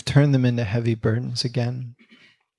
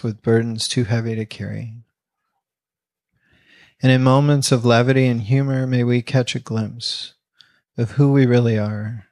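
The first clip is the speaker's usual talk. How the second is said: closing prayer. Acted. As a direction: lead the congregation gently in prayer.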